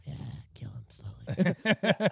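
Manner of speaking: slow, dark murmur; sinister aside. Retold or imitated. Girlfriend back to plotting, slow and dark. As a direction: savour the threat slowly. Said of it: "think about their boyfriends".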